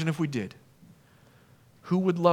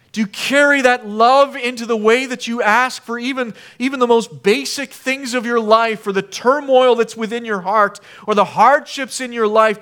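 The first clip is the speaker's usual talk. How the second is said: fervent preaching; 8 semitones higher, 9 dB louder than usual. Acted. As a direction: preach it out, louder and higher. Preaching at full force.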